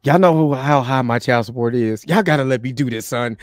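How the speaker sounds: deep, put-on earnest tone; mock serious voice. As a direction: go low as if pleading a grave case. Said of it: "let me get my serious voice".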